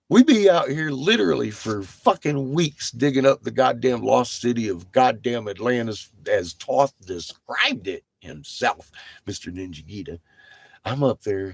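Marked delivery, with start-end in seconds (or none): none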